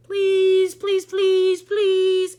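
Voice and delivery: loud, high pleading please; begging chorus. A narrator's reading. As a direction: beg over and over at the top of your voice. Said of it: "everybody begged".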